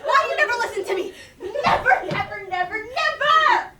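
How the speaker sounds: frantic shout, then dragged out; heated family shouting. Acted. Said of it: "I really lost it".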